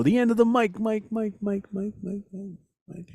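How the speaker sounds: fading mock echo on mic; echo effect. Shown as a demonstration. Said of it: "like, an echo".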